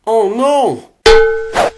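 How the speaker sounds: loud, high cry of alarm; alarmed shout. Acted. Henchman caught off guard, crying out in alarm. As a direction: yell it out in sudden panic.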